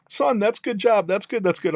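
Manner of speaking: high-pitched teasing praise; imitated old-timer's patronizing pat on the back; mock-encouraging old instructor. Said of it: "this guy's making fun of me".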